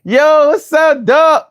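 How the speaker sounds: high, slow put-on street voice; mock New York hype greeting, high and drawn out; New York homie greeting. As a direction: play a Harlem guy hyping up the Bull's son. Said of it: "who's gonna be like".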